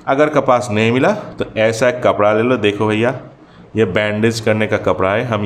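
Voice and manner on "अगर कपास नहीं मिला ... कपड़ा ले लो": ordinary talk, nothing marked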